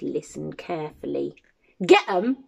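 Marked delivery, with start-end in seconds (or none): 1.8-2.4 short, mildly fierce giant's order; a touch of fierceness, as the giant; fierce command. Acted. Giant demands glasses from Jim, fiercely. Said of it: "said the giant fiercely".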